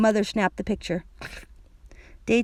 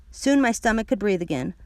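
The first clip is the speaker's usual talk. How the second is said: usual speaking voice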